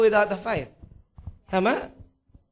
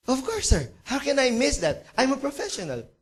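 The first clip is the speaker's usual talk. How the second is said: high-pitched, offended confidence; acted breezy self-assurance, pitched up; cocksure photographer; 6 semitones higher than usual. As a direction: brush off the boss's doubt. Photographer brushes off the doubt.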